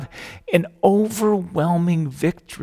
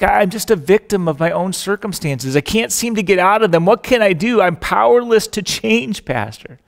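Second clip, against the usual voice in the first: mock helpless whine; 5 semitones higher, 5 dB louder than usual. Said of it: "they say stuff like".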